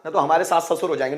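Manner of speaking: ordinary talk, nothing marked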